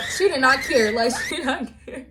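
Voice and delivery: real laughter breaking into the words; loud, high, cracking up while talking; laughing. Real, not acted. Laughs at mom's indifference.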